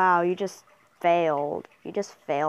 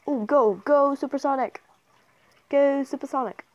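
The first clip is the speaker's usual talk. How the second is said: high, raised cheer; excited cheering; 7 semitones higher, 4 dB louder than usual. Real, not acted. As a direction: urge the player on, pitched high. Cheering on a player in game.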